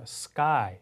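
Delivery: sky with a tight, airless K; unaspirated K. Shown as a demonstration. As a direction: say sky as "skkai". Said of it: "까 이런 발음이 나와요". (sky의 K를 '까'처럼 된소리로)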